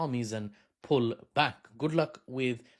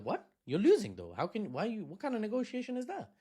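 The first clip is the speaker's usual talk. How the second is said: incredulous; 8 semitones higher than usual. Retold or imitated. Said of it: "you'd be like".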